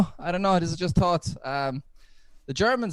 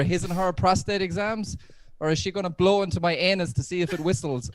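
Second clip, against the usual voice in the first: ordinary delivery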